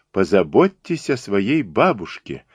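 ordinary delivery, nothing marked

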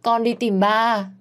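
put-on a small girl begging her dad through tears; little girl sobbing. A narrator's reading. Little Mon crying to her father on FaceTime, high child voice. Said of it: "Con bé khóc lóc quá".

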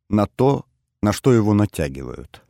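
usual speaking voice

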